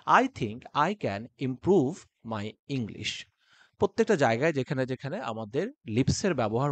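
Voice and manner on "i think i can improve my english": very slow, with clear lip movement on "improve my English"; full lip use. Shown as a demonstration. Teacher showing exaggerated lip movement. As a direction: shape every word fully with the lips. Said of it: "প্রত্যেকটা লিপটাকে আমরা properly ব্যবহার".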